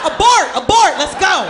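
high, loud mock alarm; urgent retreat order. Guardian pulls cousin away from danger, urgent shouting. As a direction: sound the alarm to get out. Fleeing with his little cousin.

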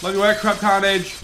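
ordinary delivery

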